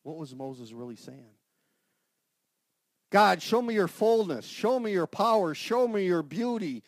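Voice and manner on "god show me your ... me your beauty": high, loud crying-out prayer; impassioned plea. Acted. Preacher voicing Moses pleading with God, loud and high. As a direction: build a fervent cry to God.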